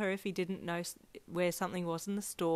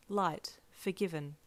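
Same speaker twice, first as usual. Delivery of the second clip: ordinary delivery, nothing marked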